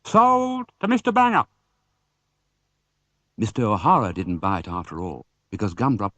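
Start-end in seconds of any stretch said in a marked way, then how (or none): 0.1-1.5 high, ringing auctioneer call; auctioneer announcing. Acted. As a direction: close the sale.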